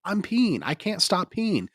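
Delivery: high, loud mock protest, slow on the second line; whiny protest. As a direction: plead it high like you truly can't stop. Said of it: "I'm like".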